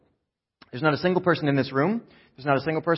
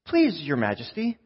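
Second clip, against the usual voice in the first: strained restraint; 7 semitones higher than usual. Acted. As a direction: plead politely, barely holding back. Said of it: "with as much restraint as they could muster".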